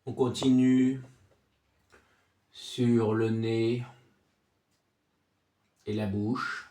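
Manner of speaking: soft, low meditation voice with long silences; guided body scan. Acted. Sophrology guide takes listener from forehead to chest, soothing guided relaxation.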